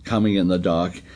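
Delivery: ordinary delivery, nothing marked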